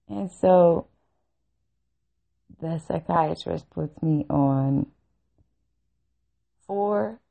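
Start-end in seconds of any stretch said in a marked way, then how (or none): none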